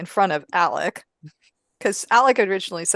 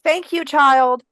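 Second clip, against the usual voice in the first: higher, louder, drawn-out thanks; calling back; 7 semitones higher, 6 dB louder, slower than usual. Acted. Nun thanks the boy as he hurries off, calling back. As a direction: call it after him slowly. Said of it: "And Martha calls back".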